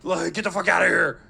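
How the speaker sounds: hammed-up angry yell; mock macho threat. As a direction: play a tough guy yelling. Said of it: "they go like".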